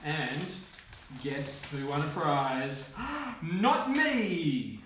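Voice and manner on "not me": gasped, high-pitched reveal; mock surprise. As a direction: deliver a hammed-up punchline in a high voice. Mock suspense about who won prize.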